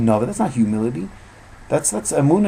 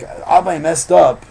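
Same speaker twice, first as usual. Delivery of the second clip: louder, slow plea to his father; pleading son; 5 semitones higher, 8 dB louder, slower than usual. Retold or imitated. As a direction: beg to be let back in. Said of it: "he's like".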